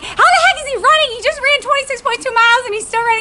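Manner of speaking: very high, incredulous squeal; retold amazement. Amazed at husband still running.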